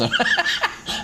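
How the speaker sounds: quick high laugh; a quick amused laugh; short laugh. Real, not acted. Laughing at sim hassle.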